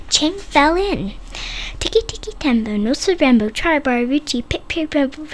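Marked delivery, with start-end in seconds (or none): none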